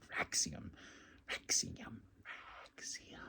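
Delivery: Fraxium repeated, each fainter until only breath; mocking the name by fading it out; fading to a whisper. Shown as a demonstration. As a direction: let Fraxium trail off into a whisper.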